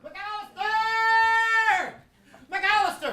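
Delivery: loud, high shout across the studio; calling out. Acted. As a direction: yell for him to come. Boss calling out backstage.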